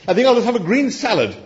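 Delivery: high-pitched, quick, sheepish order; grudging mutter. A narrator's reading. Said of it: "he muttered".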